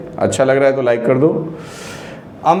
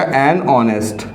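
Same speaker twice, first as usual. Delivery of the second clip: slow demo; 3 semitones lower, much slower than usual. Shown as a demonstration. Teacher to students, showing "an honest", slowly. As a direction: spell "एन honest" out word by word. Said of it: "तो यहाँ पर लग जाएगा एन".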